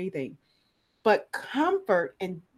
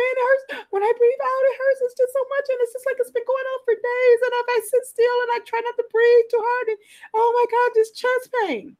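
much higher, louder voice of a suffering patient; distressed patient; 8 dB louder than usual. Retold or imitated. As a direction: play the patient pouring out her complaints.

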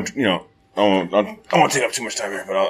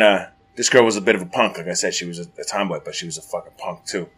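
ordinary talk, nothing marked

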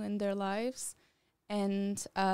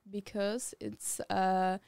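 ordinary talk, nothing marked

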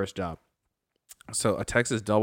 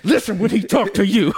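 high, louder put-on tough cat voice; cat's voice; 8 semitones higher, 6 dB louder than usual. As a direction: lay down the law as the boss cat. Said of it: "He said".

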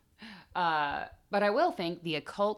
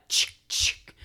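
shotgun pump noise; 6 dB louder than usual. Retold or imitated. Shotgun clack imitation.

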